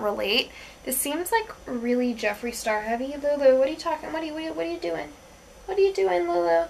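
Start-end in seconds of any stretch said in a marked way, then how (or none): 3.2-6.6 calling to the dog. Real, not acted. Scolding dog mid-video.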